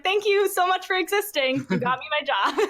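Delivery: high-pitched, playfully effusive gratitude; gushing thanks. Retold or imitated. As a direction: gush at the friend who helped. Retells gushing thanks to the girl.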